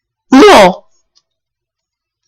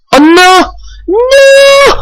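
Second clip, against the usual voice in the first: panicked cry; much slower than usual. Real, not acted. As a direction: cry out in alarm. Pokémon about to lose.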